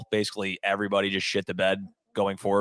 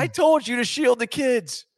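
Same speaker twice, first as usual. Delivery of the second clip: very high mock-wife voice; hammed-up scolding of a husband; exasperated wife; 13 semitones higher than usual. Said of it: "She's like".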